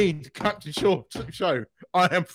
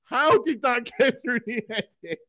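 laughing; 8 semitones higher, 4 dB louder than usual. Real, not acted. Laughing disbelief at a scene.

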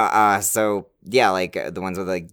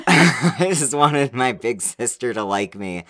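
laughing; 5 semitones higher, 5 dB louder, slower than usual. Real, not acted. Teasing sister about childhood.

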